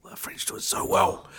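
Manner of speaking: breathy whispered "so well". Shown as a demonstration. [name] whispering his love of French pastry. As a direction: whisper it like a secret. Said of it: "repeat in whisper".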